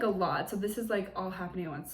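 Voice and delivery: usual speaking voice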